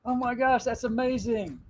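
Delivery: high, gushing surprise; mock amazement. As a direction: act thrilled and amazed. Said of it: "And I go".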